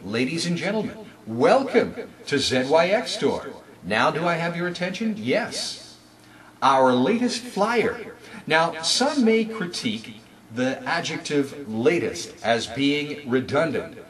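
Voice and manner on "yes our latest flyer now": usual speaking voice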